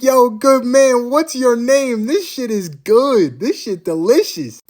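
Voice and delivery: a cocky ruler enjoying being served; smug emperor voice. Retold or imitated. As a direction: play the arrogant emperor savoring his drink. Qin voiced praising the mead.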